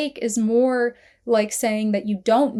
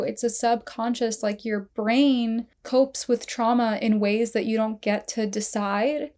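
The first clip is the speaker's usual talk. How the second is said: normal talking voice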